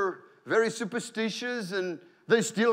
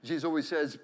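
ordinary talk, nothing marked

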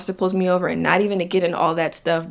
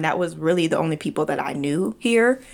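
ordinary delivery

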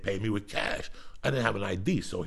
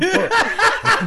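high shrieking laugh; 11 dB louder than usual. Real, not acted. Cracking up mid-story.

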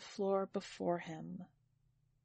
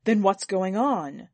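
louder, demanding questioning; insistent question; 3 semitones lower, 7 dB louder, slower than usual. A narrator's reading. Master asking everyone immediately, loud and insistent. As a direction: press them, hitting the final why hard. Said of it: "just as immediately he asked".

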